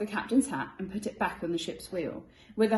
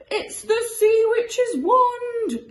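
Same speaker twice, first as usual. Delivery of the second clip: whimpering; 11 dB louder, much slower than usual. Acted. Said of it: "they whimpered".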